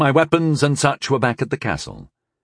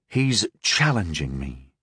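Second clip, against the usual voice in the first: low, slow, resigned; low sighing disbelief; 6 semitones lower, 4 dB quieter, slower than usual. A narrator's reading. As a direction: say it on a weary sigh. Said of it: "I sighed".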